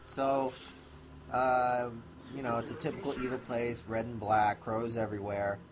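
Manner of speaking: ordinary talk, nothing marked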